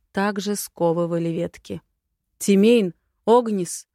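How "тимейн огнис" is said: loud, startled exclamation of names; breathed-out shock. A narrator's reading. Dragon sees his captive brothers, loud startled exclamation. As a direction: call out in dismay. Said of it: "выдохнул Валгус".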